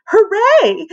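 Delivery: high, loud hooray; cheer. Acted. Girl cheers at the pool trip, high and loud. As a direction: burst out cheering. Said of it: "cried Ashley A".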